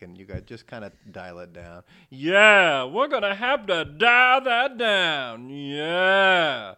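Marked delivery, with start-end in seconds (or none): 2.1-6.7 very loud, higher, slow unhinged drawl; crazy character voice. Retold or imitated. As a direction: play the crazy guy at full blast. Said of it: "my guy that I was doing was crazy".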